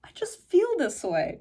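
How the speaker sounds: very high, soft and helpless; high breathy-sounding girlish plea; soft feminine voice. Shown as a demonstration. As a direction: melt the argument with sweetness. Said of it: "when I say".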